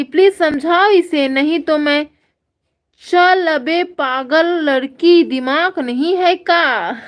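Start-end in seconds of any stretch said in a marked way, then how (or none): 3.1-7.0 slow, drawn-out mock telling-off; mock scolding. Reading a viewer's insult to the crazy girl, mock scolding. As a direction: scold with hammed-up, stretched-out outrage.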